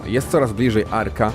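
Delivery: ordinary delivery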